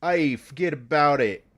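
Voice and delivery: mock New York tough guy; New York accent. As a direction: toss it off like a New Yorker. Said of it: "they know about the New York accent".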